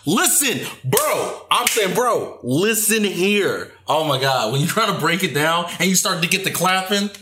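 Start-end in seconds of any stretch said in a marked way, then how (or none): 0.1-3.7 high, emphatic insistence; exasperated. Acted. Breaking down the point for Justin, exasperated. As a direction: demand attention, pitched up and drawn out.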